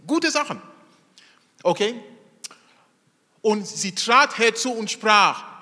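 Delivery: normal talking voice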